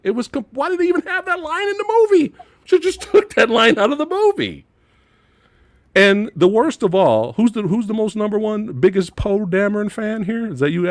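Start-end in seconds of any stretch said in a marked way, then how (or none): 0.0-4.6 incredulous rant. Real, not acted. Ranting disbelief at a line.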